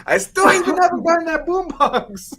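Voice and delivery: very high, loud squawk; imitated cheated caller, shrieking complaint; mock outraged customer. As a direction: wail it as the punchline.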